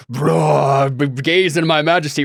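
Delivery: loud, smug mock majesty; grandiose bro voice. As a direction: boast like a lazy demigod. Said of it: "then just be like".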